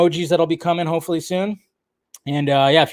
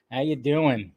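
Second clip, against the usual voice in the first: ordinary delivery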